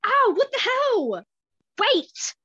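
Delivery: high, loud startled yelp; pained outcry. A narrator's reading. Boy knocked down by attackers, pained yell. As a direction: cry out as you are hit. Ambushed boys cry out in pain.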